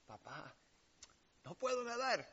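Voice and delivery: put-on high, pleading child's cry; frightened child. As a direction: voice the boy calling for his dad. Child crying to his father. (niño asustado que grita a su papá)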